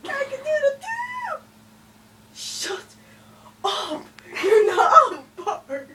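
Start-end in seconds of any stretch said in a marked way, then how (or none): 0.1-1.4 high, squealing shout; high whiny shout. Acted. Joining the animal noises. 4.4-5.9 laughing shout. Real, not acted. Laughing retort.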